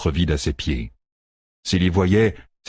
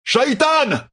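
high, full-voiced yell; shout. A narrator's reading. Rand summons his enemy, shouting. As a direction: cry the name out to the sky. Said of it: "cria Rand".

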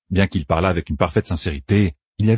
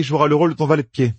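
higher, brisk and quick; Matt assigning roles; 7 semitones higher, faster than usual. A narrator's reading. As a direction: rattle off the assignment matter-of-factly. Narrator voices Matt.

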